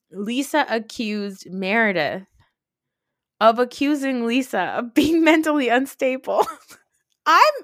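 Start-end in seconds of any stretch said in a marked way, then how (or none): 3.4-7.5 cracking up mid-sentence, getting louder; real laughing. Real, not acted. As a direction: try to finish the sentence through helpless laughter.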